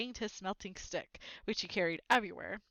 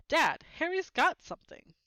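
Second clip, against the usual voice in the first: loud, higher tattle; tattling shout; 8 semitones higher, 8 dB louder than usual. A narrator's reading. Dudley telling his father about Harry's letter, loud and eager. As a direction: call it out like a snitching child.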